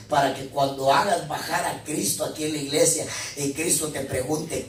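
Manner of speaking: ordinary talk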